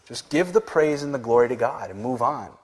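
ordinary talk

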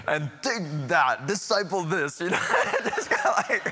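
loud, high, joking through laughter; laughing. Real, not acted. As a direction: crack up while mock-taunting. Joking through laughter after slip.

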